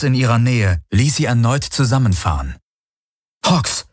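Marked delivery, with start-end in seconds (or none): 3.4-3.9 kurz und hoch ausgestoßener Name; erschrockener Ausruf. A narrator's reading. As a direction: vor Schreck den Namen ausrufen. Erschrockener Ausruf nach nahem Brüllen. (erschrocken aufrufen)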